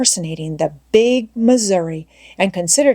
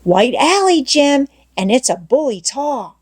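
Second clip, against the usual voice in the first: a lightly voiced coaxing boy; eager boy Tom; 6 semitones higher than usual. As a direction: coax him, bright and eager. Tom tempting with marble.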